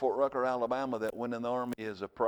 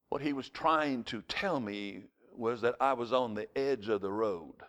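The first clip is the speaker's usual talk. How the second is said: ordinary delivery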